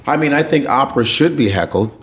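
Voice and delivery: ordinary delivery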